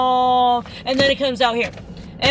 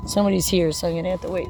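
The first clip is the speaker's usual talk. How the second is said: usual speaking voice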